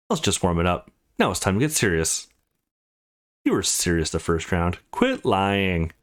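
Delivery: ordinary talk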